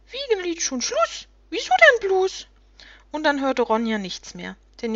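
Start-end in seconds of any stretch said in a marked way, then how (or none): 0.1-2.5 high, plaintive gnome voice; wailing little creatures. A narrator's reading. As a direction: complain pitifully as disappointed little creatures. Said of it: "Rumpelwichte unten klagen".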